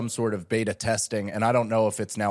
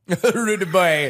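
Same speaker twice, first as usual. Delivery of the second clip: laughing; 6 semitones higher, 6 dB louder, slower than usual. Real, not acted.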